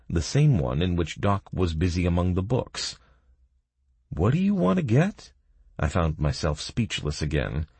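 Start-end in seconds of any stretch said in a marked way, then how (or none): 4.1-5.3 put-on high girlish character voice. A narrator's reading. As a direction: play the young woman, curious. Girl Kaelin voiced in story.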